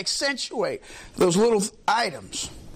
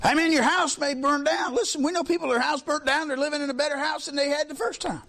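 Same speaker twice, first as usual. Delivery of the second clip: pitched-up, impassioned sermon voice that slows on the promise; fervent preaching; 7 semitones higher than usual. Acted. Preacher assuring the congregation their treasure is in heaven, fervent. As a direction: preach heaven as the true home.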